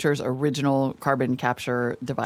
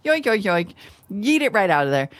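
bouncy cartoon sound effect voice; 7 semitones higher, 3 dB louder than usual. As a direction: play it like a zany cartoon. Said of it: "talking like a cartoon".

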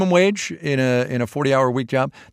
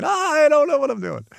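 much higher, flustered mock cry. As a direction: squeal it like you're lost. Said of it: "Molly getting too close to the mic saying".

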